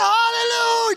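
wild shouted praise; ecstatic hallelujah. Retold or imitated. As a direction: explode with joy. Healed man praising wildly.

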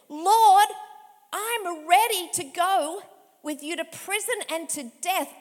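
loud, proud declaration; bold vow. Acted. Peter to the Lord, loudly pledging loyalty. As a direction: shout the promise, full of bravado. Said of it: "this is what Peter says".

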